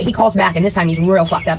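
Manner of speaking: ordinary delivery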